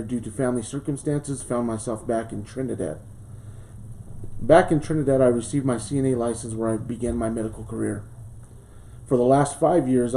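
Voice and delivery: usual speaking voice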